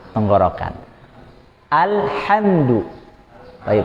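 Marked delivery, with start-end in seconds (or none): 1.7-2.8 very slow, high "Alhamdu" with a clean ḥ; clean mid-throat H. Shown as a demonstration. Teacher demonstrating after explaining, slow and clean. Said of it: "H-nya bersih".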